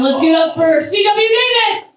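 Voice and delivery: rising, high-pitched announcer call; hype introduction. Real, not acted. Comic handing the stage to next act, hype announcement. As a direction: build up to the name for applause.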